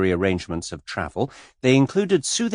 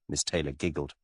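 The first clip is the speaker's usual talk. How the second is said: ordinary delivery, nothing marked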